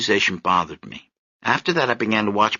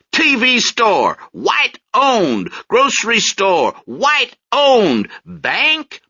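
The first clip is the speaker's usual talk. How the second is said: higher, louder street-corner preaching, slow and pointed; higher, louder corner preacher naming each store; soapbox orator; 12 semitones higher, 4 dB louder, much slower than usual. Acted. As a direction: call out each shop to the crowd like a corner preacher.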